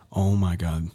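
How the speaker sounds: ordinary talk, nothing marked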